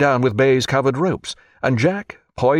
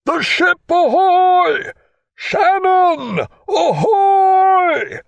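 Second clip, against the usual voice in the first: much higher, louder, long drawn-out hail across the water; hailing shout; 5 dB louder, much slower than usual. Acted. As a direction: call out to a distant ship, stretching every word. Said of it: "he hailed as he had rarely hailed before".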